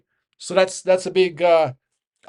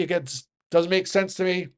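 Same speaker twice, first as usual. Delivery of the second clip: ordinary delivery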